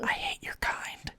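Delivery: whispered mock hatred. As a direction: hiss it like a sworn enemy. Teasing hostile whisper.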